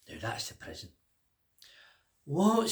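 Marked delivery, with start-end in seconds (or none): 2.2-2.7 high shocked what. Acted. Fat man to the king, high loud shock.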